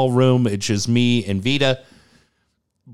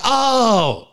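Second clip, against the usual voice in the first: sudden realization; 11 semitones higher than usual. Retold or imitated. Nurse realizing her mistake.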